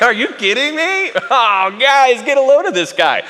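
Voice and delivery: loud, high mocking jeer; loud jeering ridicule; mocking laugh at him. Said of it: "start laughing in his face".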